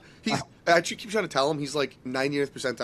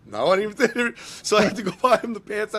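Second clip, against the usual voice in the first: higher, louder, laughing it out; 7 semitones higher, 6 dB louder than usual. Real, not acted. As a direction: laugh at yourself while telling. Laughing at own ignorance.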